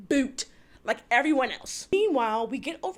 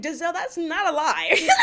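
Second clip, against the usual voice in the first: laughing retort; 5 semitones higher, 5 dB louder than usual. Real, not acted. Host answering Giselle, laughing.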